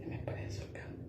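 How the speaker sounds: breathy whisper, no voice at all. Shown as a demonstration. Whispering the warning to the camera. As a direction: breathe the words without voice. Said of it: "repeated in a whisper".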